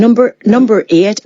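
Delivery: trying out a Northern Irish accent, repeating; attempted Northern Irish. Shown as a demonstration. Actor practising a difficult dialect, attempted Northern Irish accent. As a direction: have another go at Northern Irish. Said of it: "can't do the Northern Irish".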